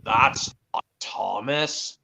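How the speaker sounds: lightly voiced surprised Gordon; low, slow Gordon. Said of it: "Are you doing U K?".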